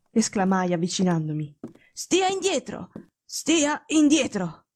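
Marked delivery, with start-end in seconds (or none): 1.9-4.7 high, drawn-out order from a weak patient; curt, imperious. Acted. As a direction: command him to keep back, imperiously. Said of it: "esclamò con quel tono secco e imperioso". (tono secco e imperioso)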